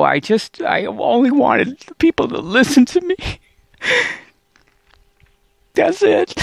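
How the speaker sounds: choked-up, pleading to be heard; crying. Real, not acted. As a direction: voice breaking high into tears. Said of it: "I'm crying".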